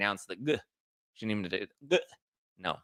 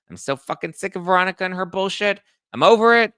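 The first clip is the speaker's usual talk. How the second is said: rising louder, higher fed-up outburst; exasperated mock rant; 9 semitones higher, 9 dB louder than usual. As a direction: blow up, sick of her. Boyfriend venting.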